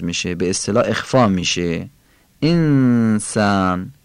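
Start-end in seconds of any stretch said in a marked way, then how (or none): 2.4-3.9 انسان said slowly, the نون hidden and drawn into the سین; slow Quranic انسان, nasal n melting into s. Shown as a demonstration. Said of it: "باید نونو کشیده در سین تلفظ کنیم".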